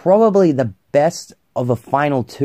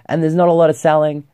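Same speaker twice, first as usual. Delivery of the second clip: normal talking voice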